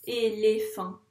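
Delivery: "Éléphant" in three distinct, even beats; syllable by syllable. Shown as a demonstration. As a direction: mark each syllable of "Éléphant" separately. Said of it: "Chaque rond correspond à une syllabe". (« Éléphant » en trois syllabes bien détachées)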